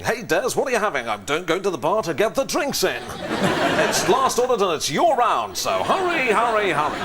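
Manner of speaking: high, loud hard-sell delivery in a pub chat; radio commercial voice. Acted. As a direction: order a round like a shouty advert. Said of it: "Nobody speaks like that in the real world".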